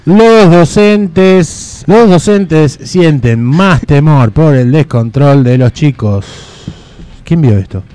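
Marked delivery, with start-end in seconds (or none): 0.0-6.2 lento y solemne, estirando las palabras; dramatic slow announcement. Acted. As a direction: anuncia el tema con dramatismo lento. Anuncio dramático de tema. (voz solemne y arrastrada)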